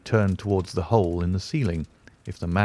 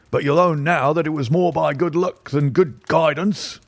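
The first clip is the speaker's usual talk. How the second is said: high, blustering police inspector; bluff, self-satisfied inspector; pompous inspector; 7 semitones higher than usual. A narrator's reading. Athelney Jones speaking, pompous.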